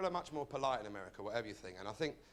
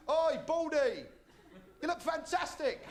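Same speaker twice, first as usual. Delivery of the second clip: put-on high, loud cheeky kid's call; kid shouting; 12 semitones higher, 4 dB louder, slower than usual. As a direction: yell it across the street like a cheeky kid. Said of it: "little kid shouting out".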